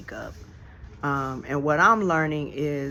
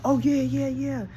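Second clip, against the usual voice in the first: eager agreeing brother; 9 semitones higher than usual. Retold or imitated. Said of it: "and they were like".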